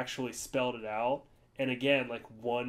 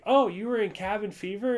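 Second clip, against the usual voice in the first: higher, louder imitation of a friend's pleased surprise; mock surprised recognition; 8 semitones higher, 7 dB louder than usual. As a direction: act out spotting who she is, pitched up. Imitates friend recognising actress at table.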